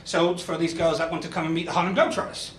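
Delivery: ordinary delivery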